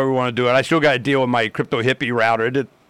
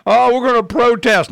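high, louder mock whine; sarcastic whiny protest voice; mocking protesters; 10 semitones higher, 5 dB louder than usual. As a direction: parody the complainers.

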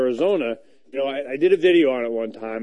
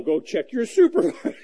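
laughing; 8 semitones higher than usual. Retold or imitated. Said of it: "retold exchange".

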